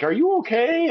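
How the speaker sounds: high, lightly mocked wife voice; mock-worried wife, high and light; wife's worried question. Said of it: "And she's like".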